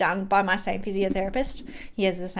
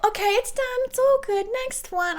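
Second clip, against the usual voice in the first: much higher, light matter-of-fact physio; the physio cheerfully moving on to the next needle; breezy physio; 13 semitones higher than usual. Retold or imitated. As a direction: brush it off like it was nothing. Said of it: "Katie's like".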